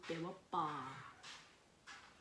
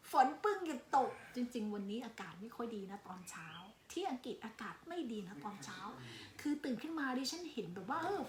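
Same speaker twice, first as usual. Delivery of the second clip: usual speaking voice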